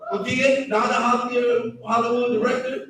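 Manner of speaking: usual speaking voice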